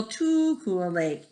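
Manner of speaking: ordinary delivery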